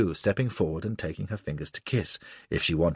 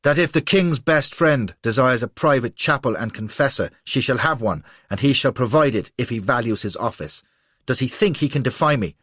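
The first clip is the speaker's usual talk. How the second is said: louder, imperious and scornful; contemptuous queen; 5 semitones higher, 6 dB louder than usual. A narrator's reading. Lola threatening the vicar general's man, imperious and scornful. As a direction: spit the threat down at him. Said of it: "husky voice charged with contempt".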